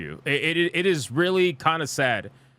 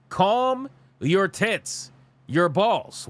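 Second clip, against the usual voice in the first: calm your tits drawn out very slowly; slow emphatic; 3 semitones higher, much slower than usual. Real, not acted. Streamer repeating his plea to the fans, dragged out slowly. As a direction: stretch every word like a lecture.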